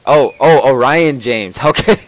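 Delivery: loud, bright realization; sudden realization. Real, not acted. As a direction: it just clicked, say it out loud. Realizes who is meant.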